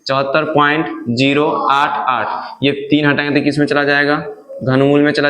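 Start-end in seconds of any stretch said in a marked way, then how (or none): 0.1-2.6 slow "चौहत्तर point", then "zero आठ आठ" as separate digits; digit-by-digit reading. Shown as a demonstration. Teacher repeating the model answer for students, "zero आठ आठ" slowly digit by digit. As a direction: spell out the decimal slowly, each of "zero आठ आठ" on its own. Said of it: "क्या पढ़ेंगे?".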